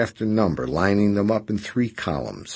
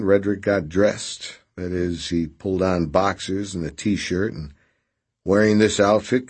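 usual speaking voice

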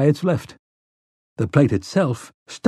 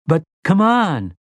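high, drawn-out plea; slow, high-pitched insistence; insistent urging; 6 semitones higher, slower than usual. A narrator's reading. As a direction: stretch it out, pleading to get moving. Boy insisting his friend leave.

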